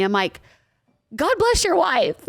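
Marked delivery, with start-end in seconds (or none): 1.1-2.3 higher, slow sarcastic quip. Retold or imitated. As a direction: pity the poor wife. Retold sarcastic reply.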